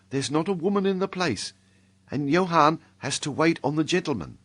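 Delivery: put-on a bashful serving girl answering the gentleman; shy inn maid.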